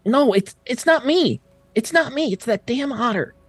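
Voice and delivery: high, frantic pleading voice; panicked, blaming the otter; distraught Woford. Acted. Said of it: "Woford is clearly distraught".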